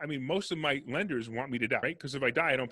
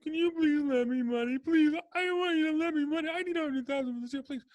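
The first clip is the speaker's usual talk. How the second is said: mock begging; 5 dB louder than usual. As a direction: mimic a needy investor begging for a loan. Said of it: "everybody's like".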